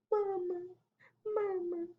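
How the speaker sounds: high, tiny whimper; soft cry. A narrator's reading. Baby llama whimpers for his mama, soft cry. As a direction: whimper softly like a little one. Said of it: "He does, like, a soft cry".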